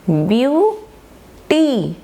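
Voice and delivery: Beauty very slow, a clear gap between view and टी; syllable by syllable. Shown as a demonstration. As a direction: stretch each syllable of beauty separately. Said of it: "मैंने अभी बताया था". (Beauty को दो हिस्सों में, ब्यू और टी, खींचकर)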